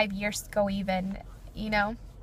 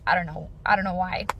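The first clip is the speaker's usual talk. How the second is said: normal talking voice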